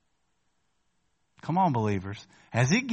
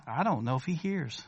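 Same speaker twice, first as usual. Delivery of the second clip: ordinary talk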